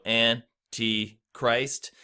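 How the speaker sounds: "Antichrist" split into three slow, separate beats; syllable by syllable. Shown as a demonstration. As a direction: break "Antichrist" into An, ti, Christ with clear pauses. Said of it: "there are three syllables in the word".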